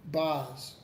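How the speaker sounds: ordinary talk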